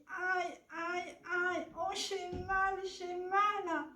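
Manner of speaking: high moan of pain, acted. Sick mother fish lies in bed, moaning. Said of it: "elle gémit".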